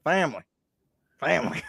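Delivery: put-on accent on a short "family"; Brian Kelly accent. Shown as a demonstration. Co-host showing off a coach's family accent, playful accent. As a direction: repeat "family" in a mock accent. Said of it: "give me your Brian Kelly family accent".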